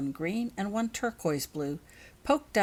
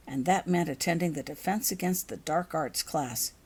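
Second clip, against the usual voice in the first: plain reading voice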